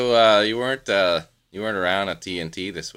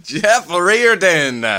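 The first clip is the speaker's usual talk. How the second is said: slow, loud drawn-out name call; announcer call; 9 semitones higher, 8 dB louder, much slower than usual. Pretend. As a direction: stretch the name like a stadium announcer.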